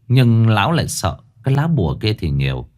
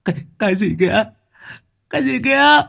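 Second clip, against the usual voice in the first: much higher, shrill with fright; terrified gasp; 12 semitones higher, faster than usual. Acted. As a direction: gasp it out in sudden panic. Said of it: "trố mắt hốt hoảng".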